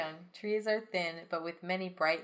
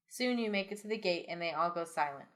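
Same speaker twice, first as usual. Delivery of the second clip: normal talking voice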